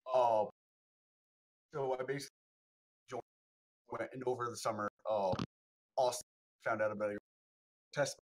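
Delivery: choppy broken-up internet audio; buzzy glitchy call audio. Real, not acted. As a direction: voice cutting in and out on the call. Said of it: "You're coming in and out real quick".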